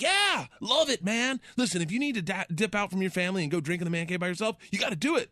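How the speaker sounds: high, excited pep-talk voice; hyped-up therapist. Retold or imitated. Therapist urging him to get weird, in an excited high voice. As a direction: pump him up like a cheering buddy.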